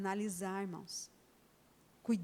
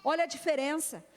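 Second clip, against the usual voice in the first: usual speaking voice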